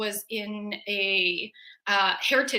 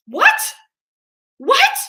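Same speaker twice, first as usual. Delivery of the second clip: high, loud squeak of disbelief; hammed-up stunned yelp; mock shock; 11 dB louder than usual. Listener hears a ghost made rice, mock shocked.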